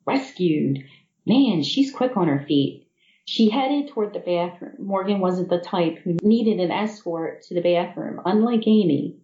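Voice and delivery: usual speaking voice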